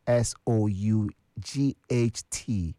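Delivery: slow, letter by letter; spelling out. Shown as a demonstration. Host giving quiz answer, spelling it out slowly.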